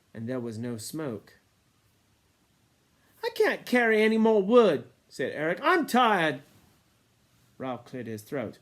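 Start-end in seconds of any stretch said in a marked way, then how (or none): 3.2-6.4 put-on tired boy. A narrator's reading. Eric exhausted.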